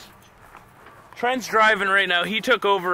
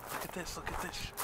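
hushed whisper; hiding during hide and seek, whispering; 3 semitones lower, 17 dB quieter than usual. Real, not acted. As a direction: whisper so nobody finds you.

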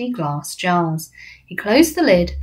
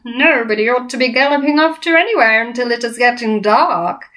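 big, loud, higher giant voice; bully giant, menacing; 5 semitones higher, 4 dB louder than usual. Acted. Flesh Lump Eater challenging BFG's outings, menacing boom.

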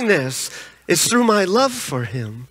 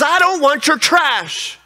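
higher, slow acted protest; mock indignation; 6 semitones higher than usual. Lady rejects the tract thrown into her car, mock indignation. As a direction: drag out an imitated indignant refusal.

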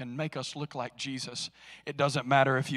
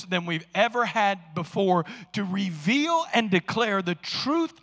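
much higher, loud, slow preaching cry; full-force preaching; 5 semitones higher, 9 dB louder than usual. Acted. Pastor rousing the congregation about opposition, full-force preaching.

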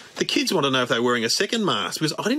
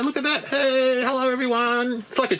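mock excited; 9 semitones higher than usual. Showing off eating mask.

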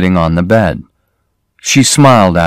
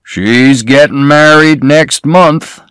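put-on slow, leering old man, a little higher; greedy old man; 6 semitones higher, 3 dB louder, slower than usual. A narrator's reading. Cassidy showing off his daughter's photo, slow leering voice. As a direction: savour each word like a greedy old boaster.

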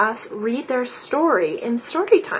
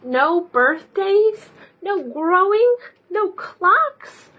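higher, slow, rising lament; mournful list; 7 semitones higher, slower than usual. Acted. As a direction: mourn each loss.

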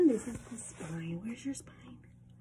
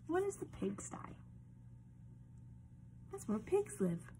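usual speaking voice

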